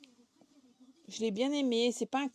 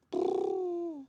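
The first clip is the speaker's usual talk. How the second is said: shudder sound; 8 semitones higher than usual. Real, not acted. Shudder at thirty pages.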